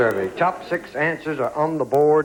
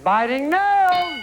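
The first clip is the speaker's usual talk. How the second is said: high, drawn-out host's shout; calling out answer; 10 semitones higher, much slower than usual. Real, not acted. Host asks the board for the steal, calling out. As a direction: stretch it out toward the board.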